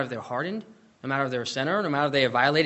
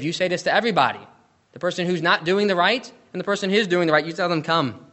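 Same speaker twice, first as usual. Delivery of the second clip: louder, rousing pulpit call; fervent preaching; 4 semitones higher, 8 dB louder than usual. Acted. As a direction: preach it out, calling everyone to come. Preacher reads invitation at full force.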